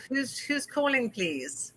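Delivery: ordinary delivery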